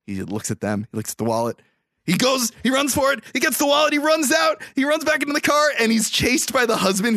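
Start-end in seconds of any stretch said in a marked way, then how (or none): 2.0-7.2 much higher, louder breathless telling; excited retelling. Real, not acted. Host tells cohost the chase, high, excited. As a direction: race through the chase with rising thrill.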